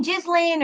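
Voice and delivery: Ghislaine said as JIZ-lane; two-syllable Ghislaine with the s sounded, JIZ-lane; alternative pronunciation. Shown as a demonstration. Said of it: "Everyone pronounces her name differently".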